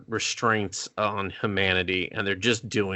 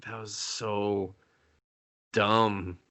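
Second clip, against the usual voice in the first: deadpan disgust; 3 semitones lower, 5 dB quieter, much slower than usual. Retold or imitated. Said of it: "and I was like".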